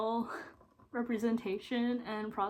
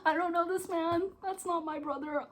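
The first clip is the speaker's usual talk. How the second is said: high crying voice, louder; tearful outburst; 10 semitones higher, 3 dB louder than usual. Retold or imitated. Vlogger reacting to the film through tears, high crying voice. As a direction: blurt it out through sobs, much higher. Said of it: "Bro, I'm like".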